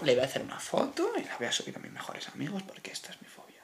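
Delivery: soft unvoiced whisper. Real, not acted. Whispers an aside to his best friends. Aside about sharing a photo.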